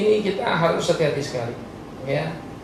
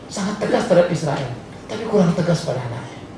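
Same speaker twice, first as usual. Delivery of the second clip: usual speaking voice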